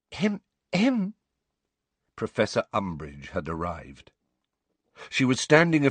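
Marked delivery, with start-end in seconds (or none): 0.1-1.1 high, prim throat-clearing; little fake cough to get attention; affected high "hem, hem". A narrator's reading. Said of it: "Umbridge's fake cough".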